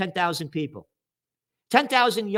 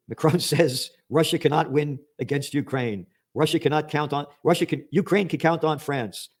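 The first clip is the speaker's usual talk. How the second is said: ordinary talk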